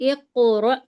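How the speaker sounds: اقرأ with a jolted, echoing qaf; qalqala bounce. Shown as a demonstration. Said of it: "تو ہم اس کو جھٹکا دے کر پڑھیں گے".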